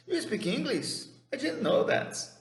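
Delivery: very high-pitched, astonished question and remark; acted surprise. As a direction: play amazed at finding this out. Acts out surprise at English speaker. (surpresa encenada, voz bem aguda)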